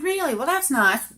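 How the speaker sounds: high-pitched, impressed reaction; mock-naive, lifted "oh, really"; naive friendly surprise. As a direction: react brightly, taken in by it. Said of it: "I said".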